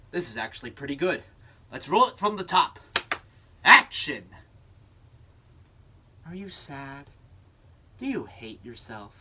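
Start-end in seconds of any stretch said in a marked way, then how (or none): 3.6-4.2 loud shouted "Action."; director's call. Acted. Director calls the second take. 6.3-9.1 commercial voice-over. Acted. Second commercial read.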